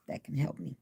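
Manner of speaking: ordinary talk, nothing marked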